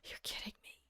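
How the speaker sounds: barely voiced whisper of disbelief; hushed, real disbelief; stunned whisper. Real, not acted. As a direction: whisper it, too stunned to speak up. Stunned by word of year.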